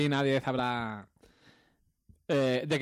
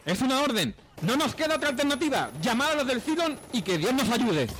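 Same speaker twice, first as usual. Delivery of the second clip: high, urgent commander's order; dramatic movie voice; 10 semitones higher, 4 dB louder than usual. Acted. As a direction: bark the order in desperation. Dramatic intro clip dialogue.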